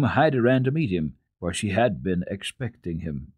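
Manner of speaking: plain reading voice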